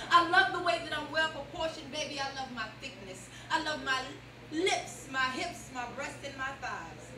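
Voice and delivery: ordinary talk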